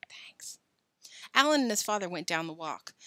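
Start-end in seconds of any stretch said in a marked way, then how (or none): 0.1-0.6 barely audible whisper. A narrator's reading. Billy's father taking his sleeping son, whispering. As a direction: breathe it out very softly. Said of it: "whispered his thanks".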